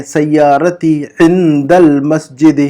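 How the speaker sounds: Arabic phrase said slowly and carefully; slow model reading. Shown as a demonstration. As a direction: sound out सय्यारती Indal मस्जिद word by word. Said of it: "मेरी गाड़ी मस्जिद के पास है". (धीमे, साफ़ उच्चारण)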